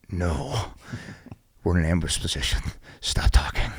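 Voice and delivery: soft hushed reply, mostly whispered; telling a buddy to stop talking in the ambush, whispered. Retold or imitated. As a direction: hush him, still whispering.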